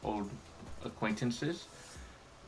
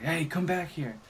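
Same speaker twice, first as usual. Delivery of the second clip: mock commanding yell; 6 semitones higher than usual. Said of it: "oh, yeah, he yelled at me".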